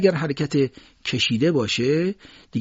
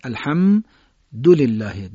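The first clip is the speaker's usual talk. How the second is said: الحمدلله slow and louder, held ham before dulillah; leaning on m; 5 dB louder, much slower than usual. Shown as a demonstration. Teacher drilling listeners, stressed m. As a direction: hum long on the m in الحمدلله, then join dulillah. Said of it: "تکیه ایجاد بکنید".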